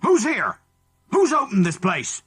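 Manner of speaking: high, loud angry yelling; a second man shouting angrily. Acted. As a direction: roar the questions in fury. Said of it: "angry and loud".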